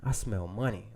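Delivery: a mild imitation of the savoring mentor. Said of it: "he just sniffed his nose and like".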